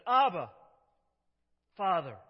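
loud, high call to the father; Jesus calling his Father, anguished; desperate cry. Acted. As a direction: cry out like a child in pain. Jesus crying out to God.

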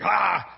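loud exasperated "Ah!"; exasperated yell. Retold or imitated. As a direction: cry out in comic irritation. Steve exasperated by irritating companion.

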